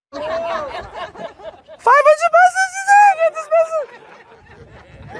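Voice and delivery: very high, squealing mock laughter; slow, squeaky hammed-up laughing fit; imitated helpless laughter. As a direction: wheeze the words out as if cracking up. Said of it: "They laughed so hard".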